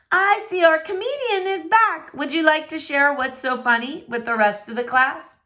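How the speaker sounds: a teacher singling out the giggler; sarcastic teacher. Acted. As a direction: call out the class clown with mock sweetness. Teacher scolding the laughing student.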